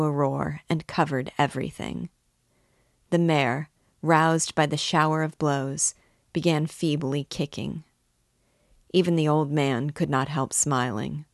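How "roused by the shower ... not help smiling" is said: ordinary delivery, nothing marked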